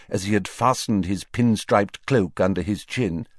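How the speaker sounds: ordinary delivery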